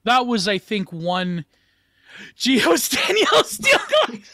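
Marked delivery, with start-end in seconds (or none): 2.4-4.3 high laughing through the words. Real, not acted. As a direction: laugh while saying it. Laughing at a joke.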